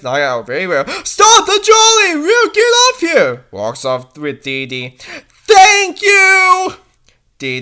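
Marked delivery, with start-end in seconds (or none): 1.0-3.4 high, loud call to halt the trolley; urgent high holler; calling out to stop. Acted. Niall halts the trolley. 5.5-6.7 loud cry. Acted. Relieved cry to driver.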